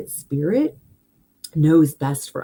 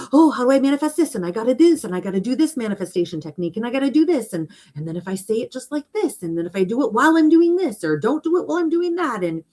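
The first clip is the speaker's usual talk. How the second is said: higher and fast, rattling off wishes in a frenzy; frantic mock voice; 6 semitones higher, faster than usual. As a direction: mimic a frazzled seeker, breathless and higher. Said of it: "all the craziness of like".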